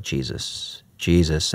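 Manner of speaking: breathy near-whisper of the name; hushed repetition. Retold or imitated. Student kneeling in adoration, whispering. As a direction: murmur the name under your breath.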